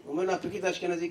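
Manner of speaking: ordinary talk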